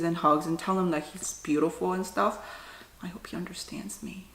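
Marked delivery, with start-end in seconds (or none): 3.0-4.3 whisper. Real, not acted. Sad about shaved dog.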